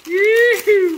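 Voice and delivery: a high, loud whoop of joy; a loud cowboy whoop; excited whoop. Real, not acted. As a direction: let out a big happy yee-hoo. Cheering over the collectibles.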